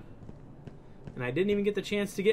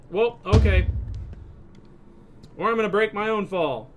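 usual speaking voice

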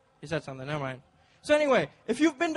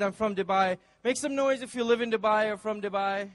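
ordinary talk

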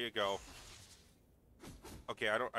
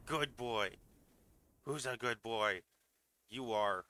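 pet praise voice; 3 dB louder, slower than usual. Real, not acted.

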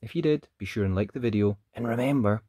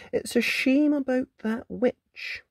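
Rapunzel's voice; 13 semitones higher than usual. Acted. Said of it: "she thought to herself".

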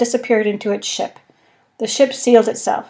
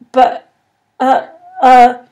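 flustered stammer; 3 semitones higher, 8 dB louder, slower than usual. A narrator's reading. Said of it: "he managed finally to say".

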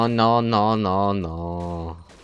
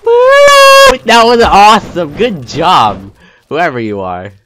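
loud, high excited whoop; cheering; 8 semitones higher, 14 dB louder, slower than usual. Real, not acted. Gamer cheering another player's stunt, loud and high. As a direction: cheer like you just won.

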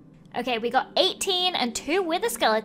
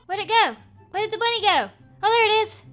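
panicked; 7 semitones higher, 5 dB louder, faster than usual. Real, not acted. Bunny suddenly vanishes.

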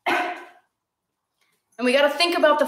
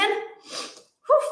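relieved whew; 10 semitones higher than usual. Real, not acted. After sneezing fit.

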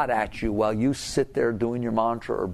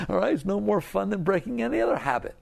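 ordinary delivery

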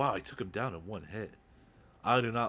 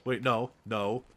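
panicked; 6 semitones higher, 7 dB louder than usual. Real, not acted. Panicking under attack in game.

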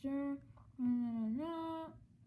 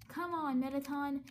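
usual speaking voice

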